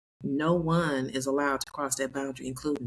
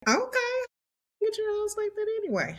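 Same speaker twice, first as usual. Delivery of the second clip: high, mock-hurt pout; sulky comeback. Mock retort to her son's rules: high pouty sulk. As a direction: huff it back like a stung mom. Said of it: "And I was like".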